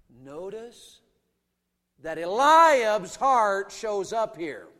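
ordinary talk, nothing marked

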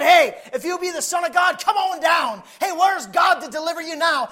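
high, shouted taunts; mocking. Passers-by at the cross taunt Jesus, shouted mockingly. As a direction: jeer loudly in full preaching voice. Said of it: "they're mocking him".

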